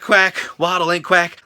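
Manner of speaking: ordinary delivery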